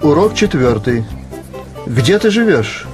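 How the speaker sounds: usual speaking voice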